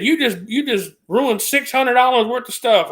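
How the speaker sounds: high, accusing imitation; accusing boss. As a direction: lay the blame on her. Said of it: "she said".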